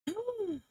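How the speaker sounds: high, soft drawn gasp; quick astonished intake; shocked gasp. Real, not acted. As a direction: gasp in surprise. Shocked at the late hour.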